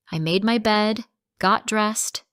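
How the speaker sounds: usual speaking voice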